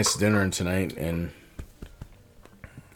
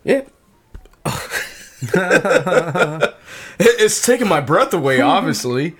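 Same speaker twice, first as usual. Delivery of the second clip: high breathless laughing words; 8 semitones higher, 7 dB louder than usual. Real, not acted. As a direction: laugh while catching breath. Laughing through hiccup fit.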